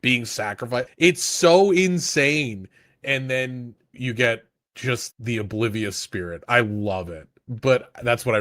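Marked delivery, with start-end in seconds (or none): 1.0-2.7 incredulous. Real, not acted. Reacting to the sacrifices.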